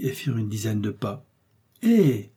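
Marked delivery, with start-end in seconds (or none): 1.8-2.3 sudden high call of Hé!; a sharp cry to stop his friends; startled outcry; exclaiming. A narrator's reading. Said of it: "s'écria Athos".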